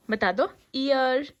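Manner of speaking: put-on slow, stretched coaxing to baby. Shown as a demonstration. Aunt coaxing baby to say ear, slow and stretched. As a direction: draw out each word for the child. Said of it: "coaching baby slowly".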